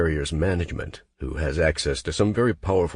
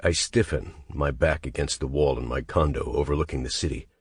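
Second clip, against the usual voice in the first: ordinary delivery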